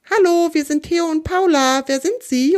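high, carrying introduction to strangers; storybook hail to silent figures; calling out. A narrator's reading. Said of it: "ruft der kleine Buschibau noch einmal".

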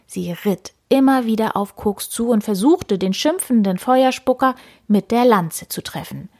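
usual speaking voice

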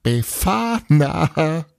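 high, slow, stretched-out crowing cry of a name; gleeful cry. A narrator's reading. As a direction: crow the name out in glee. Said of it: "als Tapani sehr laut auflachte".

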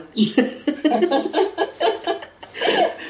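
bright high laugh bursting out; real laugh. Real, not acted. Amused at the client's mirror ritual, real laugh.

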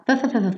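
"f, f, f, f" as a voiceless fricative like the th in "nothing"; tongue on the front teeth for "f, f, f, f"; th sound demo. Shown as a demonstration. Teacher modeling the th sound for students. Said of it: "T H é sempre linguinha".

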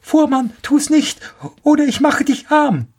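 very high sparrow cry; calling out a warning. A narrator's reading. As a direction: warn the carter in a high bird voice. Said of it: "Da rief er". (hoher, warnender Ruf des Spatzen)

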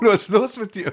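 high-pitched, incredulous challenge; mock outrage. Storyteller challenging bluffing friend, indignant and high. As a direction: challenge him, voice jumping up high.

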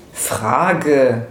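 a slow, falling, dismissive Frage; Frage. spoken as a cocky, dismissive retort; curt character voice. A narrator's reading. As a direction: let Frage. drop off slowly, as the sneering Max. Max's answer in character voice.

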